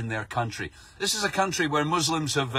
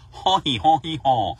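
mock donkey bray; 3 dB louder than usual.

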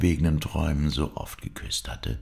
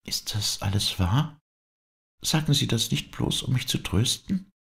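put-on higher young woman's voice, hopeful and hushed; breathed hopeful question from a girl, higher; 11 semitones higher than usual. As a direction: Dorette asking in disbelief, pitched higher. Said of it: "hauchte sie".

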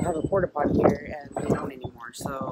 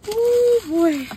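much higher and louder, startled; loud startled exclamation; 11 dB louder than usual. Real, not acted. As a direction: yelp it out in surprise. Reacting to something sudden.

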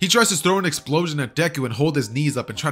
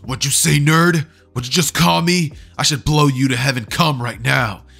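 loud, aggressive challenge, pitch pushed up; angry bully; 5 dB louder than usual. Acted. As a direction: get in his face and threaten him. Acted quarrel, Bakugo threatening.

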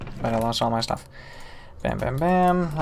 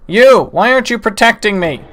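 louder, higher accusing yell; outraged call-out; indignant shout; 6 semitones higher, 7 dB louder than usual. Real, not acted. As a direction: bark at the useless ally. Yelling at game companion.

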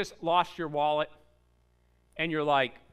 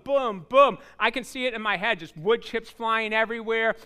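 ordinary talk

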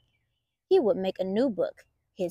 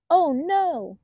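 Oh no; 7 semitones higher, 5 dB louder than usual. Acted. Bunnies eating the book.

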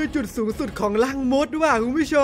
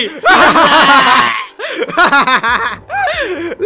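loud laughter; 7 dB louder than usual. Real, not acted. Big laugh after teammate dies.

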